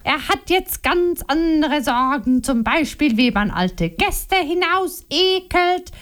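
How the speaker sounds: mock her husband's excuses with scorn; Liliana, accusing. A narrator's reading. Wife accusing her husband before Momo, scornful.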